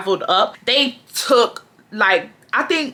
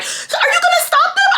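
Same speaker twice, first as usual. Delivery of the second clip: squeaky high mock customer; a customer whining at the cashier, squeaky and high. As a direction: pitch way up as the whiny customer. Customers asking her to intervene.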